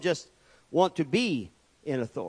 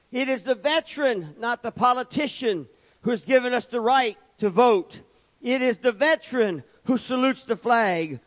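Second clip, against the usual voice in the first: full-force preaching; 6 semitones higher than usual. Acted. Preacher rousing the congregation with full-force preaching. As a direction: build each line like a sermon peak.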